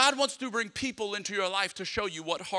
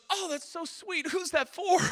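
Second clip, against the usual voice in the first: much higher, slow and sugary lady voice; sweet cake lady; 13 semitones higher than usual. Retold or imitated. Bakery lady cooing over the cake message, sweetly high. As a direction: coo it like a charmed shop clerk.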